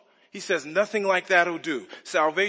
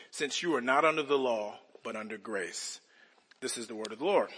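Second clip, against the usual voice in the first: measured, lower solemn reading of the text; scripture reading; 4 semitones lower than usual. Acted. Pastor reading Romans to the congregation, solemn and measured. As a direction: read the passage with reverent weight.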